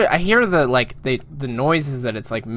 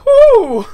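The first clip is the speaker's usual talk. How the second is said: high breathless whoo; 10 dB louder than usual. Real, not acted. Still laughing after scare.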